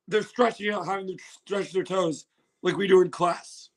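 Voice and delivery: ordinary talk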